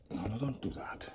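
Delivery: urgent soft whisper. Real, not acted. Keeper whispering to a spider to stop, urgent whisper. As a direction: hush it, stop the spider gently.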